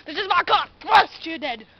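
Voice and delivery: loud, punchy, hammed-up yell; mock carjacker shout. Imitating Lucas as a carjacker, shouted. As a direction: shout it like a cartoon attacker.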